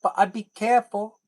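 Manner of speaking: put-on high little-kid voice; pretend child. Imagined daughter argues with papa, high child voice. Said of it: "she'd be like".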